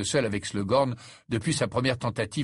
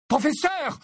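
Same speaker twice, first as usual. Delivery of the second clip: very high, alarmed cry; urgent yell; 4 dB louder than usual. Acted. Harry alerting his professor as Ron collapses, yelling. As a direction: cry out for help. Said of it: "hurla Harry".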